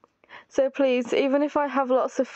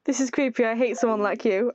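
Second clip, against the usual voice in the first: usual speaking voice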